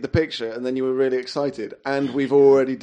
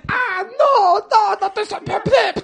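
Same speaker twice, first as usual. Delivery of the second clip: high puppet voice; 7 dB louder than usual. Acted. Puppet voice protesting.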